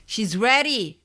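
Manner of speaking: a loud, high announcing shout. A narrator's reading. Old man shouts that she is ready. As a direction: call it out, big and high. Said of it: "the old man shouted".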